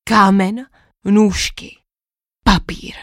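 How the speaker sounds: solemn knight, each word weighed; hint of a grand pronouncement; dramatic monologue. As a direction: knight announcing the legendary game, lightly dramatic. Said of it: "svůj dramatický monolog".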